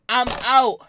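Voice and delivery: ordinary talk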